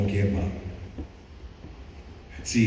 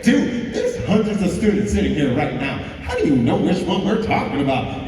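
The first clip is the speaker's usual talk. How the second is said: incredulous; 5 semitones higher, 4 dB louder than usual. Retold or imitated. Friends' incredulous reply.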